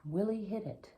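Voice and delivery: usual speaking voice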